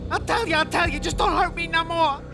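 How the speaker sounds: high-pitched, panicky begging; frightened pleading. Acted. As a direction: give in and beg for mercy. Crook gives in, begs cop.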